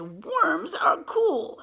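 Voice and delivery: put-on high, loud, drawn-out boy voice; boy's exclamation. A narrator's reading. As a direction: call it out slowly like an enthusiastic boy. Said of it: "This boy says".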